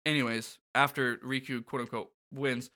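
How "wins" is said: ordinary delivery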